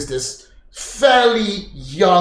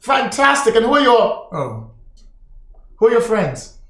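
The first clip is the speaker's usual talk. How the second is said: cheery barkeep; 7 semitones higher than usual. Acted. Barkeep voice continues.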